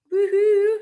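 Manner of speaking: high, loud whoop of delight; excited celebratory whoop; woohoo cheer. Real, not acted. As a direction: whoop for the viewer. Celebrating viewer's order.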